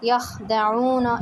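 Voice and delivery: teacher models यखदऊना without snoring; slow, light kha. Shown as a demonstration. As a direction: keep the ख in यखदऊना gentle and slow. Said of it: "इस तरह से यख".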